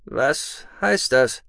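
slow and suspicious; lurking, wary. Acted. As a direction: probe warily, drawn out. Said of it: "fragte Imra lauernd".

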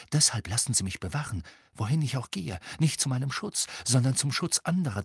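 pitched high, mounting alarm; agitated inner voice. Acted. Harry realises he is being used, increasingly agitated. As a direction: build from urgent reasoning to anguished realisation.

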